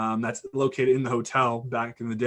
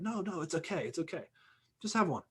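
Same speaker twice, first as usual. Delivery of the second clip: coaxing; 5 semitones higher, 7 dB quieter, faster than usual. Retold or imitated. Tony coaxing guy to smoke.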